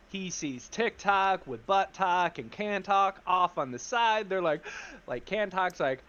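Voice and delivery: ordinary talk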